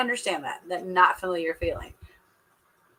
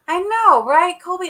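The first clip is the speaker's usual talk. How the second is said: excited agreement; 10 semitones higher, 9 dB louder than usual. Real, not acted. Agreeing loudly with chat.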